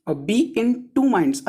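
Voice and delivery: the idiom read out very slowly, word by word. Shown as a demonstration. Teacher reading the question to students, very slowly. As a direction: stretch each word of the idiom.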